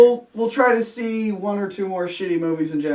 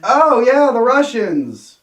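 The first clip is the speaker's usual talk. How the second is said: drawn-out mock enthusiasm, high and loud; sarcastic oh-yeah with a slow falling the Russians; 7 semitones higher, 7 dB louder, slower than usual. Real, not acted. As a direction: sneer at the cliché, stretching it slowly. Mocking the dated villain choice.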